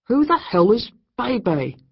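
high, loud, slow demand; incredulous demand. A narrator's reading. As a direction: demand it in disbelief. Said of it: "Jake asked".

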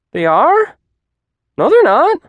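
high, loud protest; disbelieving student. A narrator's reading. Student contradicting the teacher's claim, high and incredulous.

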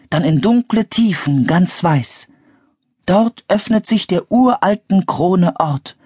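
high-pitched, measured verse recitation; reading poem aloud. A narrator's reading. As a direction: recite the riddle line by line in a high voice. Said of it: "Anne las Morgans Gedicht laut vor". (hoch vorgetragenes Gedicht)

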